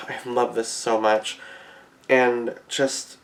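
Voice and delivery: usual speaking voice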